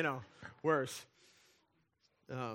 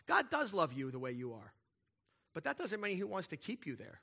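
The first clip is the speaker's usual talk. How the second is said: ordinary delivery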